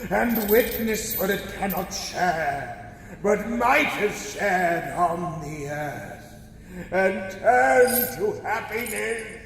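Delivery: mild ghostly sorrow; mournful lament. Acted. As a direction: grieve for what was lost.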